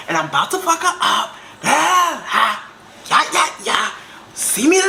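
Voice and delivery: high, hammed-up fighting talk breaking into laughs; acted angry rant. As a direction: rant like you're calling in backup.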